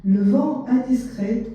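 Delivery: measured poetry reading, spaced line by line; poem recitation. Acted. Translator reading short poems to listeners, poem recitation. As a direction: recite it with deliberate pauses between phrases.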